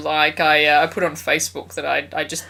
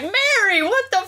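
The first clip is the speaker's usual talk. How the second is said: high-pitched appalled exclamation; exasperated outrage; 14 semitones higher than usual. Retold or imitated. Viewer scolding Mary for her cruelty, appalled. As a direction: cry out in disbelief, pitch shooting up.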